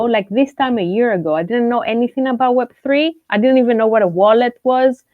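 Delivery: normal talking voice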